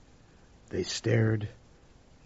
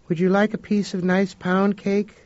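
mother's voice; 9 semitones higher, 6 dB louder than usual. Acted. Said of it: "Leona said".

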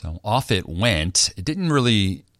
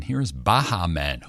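ordinary talk, nothing marked

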